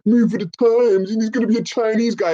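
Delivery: high mocking voice of the critics; high sing-song parody. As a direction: mimic them in a silly high whine. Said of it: "It's like".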